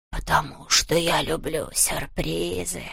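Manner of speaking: slow, soft whispery ghost; ghostly whisper. A narrator's reading. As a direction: explain it like a smug ghost. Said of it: "воскликнул Хуга". (шёпот привидения)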